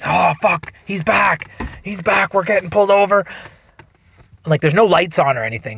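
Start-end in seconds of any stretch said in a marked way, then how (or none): 0.1-3.2 higher-pitched mock panic; freaking out. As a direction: imitate a buddy freaking out, in short bursts. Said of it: "my buddy starts freaking out again".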